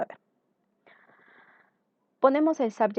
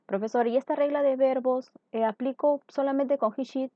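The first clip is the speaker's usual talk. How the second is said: lightly voiced student with raised pitch; imitating a student; 6 semitones higher than usual. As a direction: ask as a pupil would, higher. Teacher voices a student's question. (alumno que pregunta, imitado con voz más aguda)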